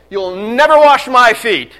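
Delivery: loud, slow, adamant "never wash"; Peter's loud refusal. Retold or imitated. Peter refusing Jesus, loud and indignant. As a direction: shout the refusal like an indignant disciple. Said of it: "So what does he say?".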